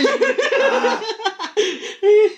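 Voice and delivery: shrill burst of laughter; a long high cackle. Real, not acted. Friend laughs at game.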